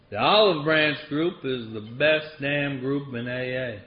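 slow, drawn-out Southern accent imitation; thick Southern accent. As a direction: imitate a small Southern man introducing himself, slowly. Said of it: "he had this thick Southern accent".

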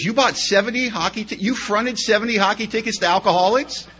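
mock outrage at the sum; incredulous wife. Wife hearing about the ticket charge, incredulous. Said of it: "She goes".

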